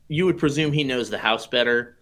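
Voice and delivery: ordinary delivery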